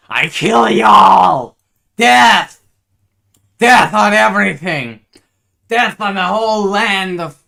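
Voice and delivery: booming villain shouting doom, slow; evil roaring rant. Acted. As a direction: bellow every word like a mad tyrant. Villain rants of death and doom.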